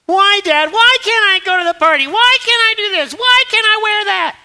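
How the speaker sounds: high, loud whine of a child badgering Dad; pestering kid. Acting out kids badgering dad.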